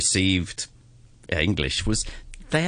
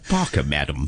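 mock prewar posh English, pitch dropping sharply on the second word; 1930s British accent; 6 semitones lower than usual. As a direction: mimic an old-fashioned upper-class British recording. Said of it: "that is how people spoke in the nineteen thirties".

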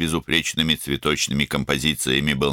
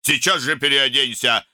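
raised, high-pitched order; angry command; 9 semitones higher than usual. A narrator's reading. Older brother sending younger off to change, angry command. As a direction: fire it off as a furious command.

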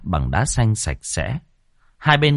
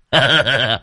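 higher-pitched chuckling laugh; acted laugh; 5 semitones higher, 3 dB louder than usual. Thầy Long jokes about her new look, acted laugh. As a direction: laugh at your own teasing joke.

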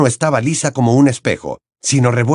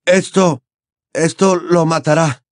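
breathless; 7 semitones higher than usual. Acted. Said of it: "dijo el muchacho entrecortadamente".

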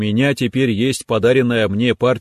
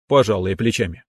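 ordinary delivery, nothing marked

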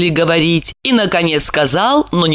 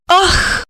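sigh; 12 semitones higher than usual. A narrator's reading. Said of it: "вздохнула она".

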